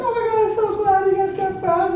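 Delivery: high mock-tearful relief; high, put-on weepy voice; imitated crying. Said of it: "like, crying".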